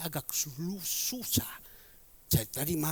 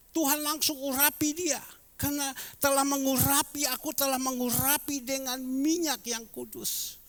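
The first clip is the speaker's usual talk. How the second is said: loud, higher sermon voice; preaching; 9 semitones higher, 7 dB louder than usual. Acted. Pastor addressing Bapak Ibu, full preaching voice. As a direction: drive the point home to the church.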